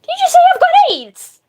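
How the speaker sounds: high, loud, incredulous question; real shocked disbelief. Real, not acted. Streamer to partner after mishearing a remark, shocked. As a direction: blurt it out in high-pitched disbelief.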